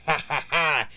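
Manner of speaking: mock triumphant ha-ha-ha; put-on gloating chuckle; gloating laugh. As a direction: laugh smugly as the bargain hunter. Bargain hunter's gloating laugh, acted.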